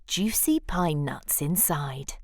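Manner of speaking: plain reading voice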